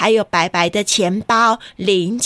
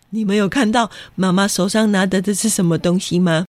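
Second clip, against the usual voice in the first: ordinary delivery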